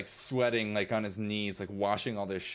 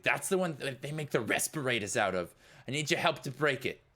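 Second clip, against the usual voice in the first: raised, higher-pitched character voice; urgent demand; 6 semitones higher than usual. Acted. Eddie asks Jupiter to help break the rack, urgently. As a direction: press for help in the middle of the job.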